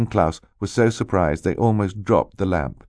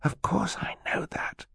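hushed whisper between siblings; 13 semitones higher, 9 dB quieter than usual. A narrator's reading. Sister answering her brother about the lamp, whispered. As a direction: whisper it back, barely voiced. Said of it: "Violet whispered".

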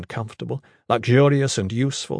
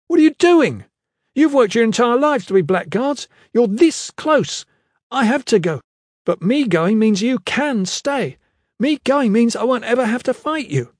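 high, loud, agitated pleading; impassioned protest; 11 semitones higher, 5 dB louder than usual. Acted. Kip begs the squad to stay behind, shouting in protest. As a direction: plead at the top of your voice.

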